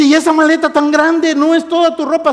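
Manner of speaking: high, loud sister's challenge; mock suspicious questioning. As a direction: question loudly in a high pitch.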